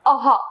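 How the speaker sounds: अह said as a short breathy ah-ha; अह voiced as a clear aspirated ah; visarga sound demo. Shown as a demonstration. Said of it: "इसका उच्चारण हा के समान होता है".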